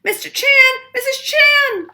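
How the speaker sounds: very high, loud startled cries; a girl caught, panicking; cried out. A narrator's reading. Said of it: "she cried".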